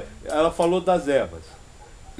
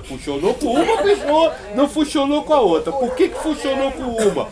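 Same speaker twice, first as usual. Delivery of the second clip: raised, high-pitched rhetorical challenge; emphatic rebuttal; 7 semitones higher, 3 dB louder than usual. Real, not acted. Rebutting a herbalist on why herbs work, emphatic challenge. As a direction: drive each contrast home, pitched high.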